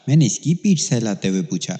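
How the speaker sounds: ordinary delivery, nothing marked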